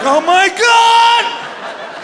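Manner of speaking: high, drawn-out yell; mock horror. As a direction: stretch it into a comic cry of dread. Horror at coiled scope cord.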